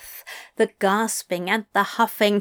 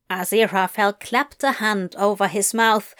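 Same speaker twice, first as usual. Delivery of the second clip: ordinary delivery, nothing marked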